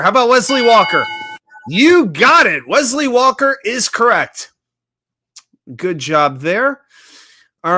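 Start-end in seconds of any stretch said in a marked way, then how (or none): 0.0-1.1 louder, higher, quicker game-show call of the guess; gleeful game host announcing a correct answer; excited host call. Real, not acted. 1.7-4.5 higher, louder congratulations; cheering a viewer's correct trivia guess; excited. Real, not acted. Host confirms correct answer.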